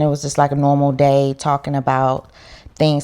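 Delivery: ordinary talk, nothing marked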